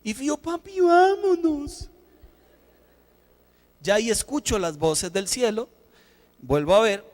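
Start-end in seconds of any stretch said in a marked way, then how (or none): 0.3-1.9 put-on child's voice. Daughter pleading.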